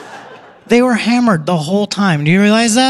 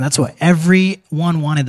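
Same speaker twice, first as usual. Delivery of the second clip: usual speaking voice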